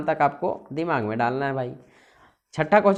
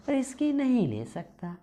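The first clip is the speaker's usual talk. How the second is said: high, soft, slow mock refusal; reluctant prime minister; 6 semitones higher, 5 dB quieter than usual. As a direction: back out feebly, pitched up and drawn out. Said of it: "बोल रहे हैं प्रधानमंत्री जी". (प्रधानमंत्री का धीमा, दबा हुआ नाटकीय इनकार)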